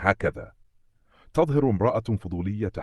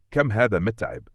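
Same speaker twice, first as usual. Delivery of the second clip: plain reading voice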